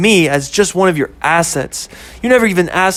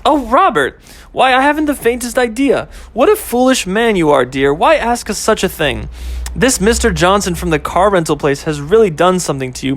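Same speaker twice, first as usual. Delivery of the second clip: higher, hammed-up old-fashioned lady voice; mock genteel lady; 5 semitones higher than usual. Girlfriend mocking boyfriend's proposal, hammed-up genteel lady. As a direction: play the prim belle teasing him.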